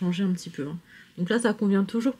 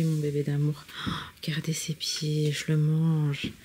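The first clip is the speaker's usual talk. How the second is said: whispering; 5 semitones lower, 6 dB quieter than usual. Real, not acted. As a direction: whisper tenderly, slowing down. Admiring the sleeping baby.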